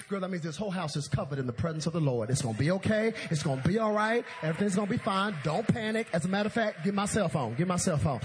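loud, rapid-fire preacherly comforting; rally a panicking sister, loud and sure; emphatic reassurance. Acted. Martha tells her crying sister it's okay, forceful reassurance.

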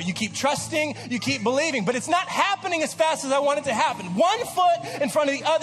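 building sermon climax; preaching at full force. Acted.

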